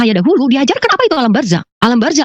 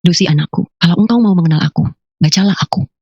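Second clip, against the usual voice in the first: low, measured voice from beyond; divine voice; 5 semitones lower than usual. Retold or imitated. Mysterious voice telling Lucy to read it, low divine voice. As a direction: speak low, as God addressing a child. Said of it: "Suara itu berkata begini".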